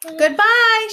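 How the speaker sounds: high, loud farewell shout; the whole crowd calling up. Acted. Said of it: "shouted everyone".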